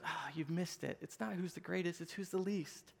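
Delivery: hushed, patient letdown; gentle correction. Retold or imitated. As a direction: imitate a teacher quietly setting them straight. Jesus gently corrects arguing disciples.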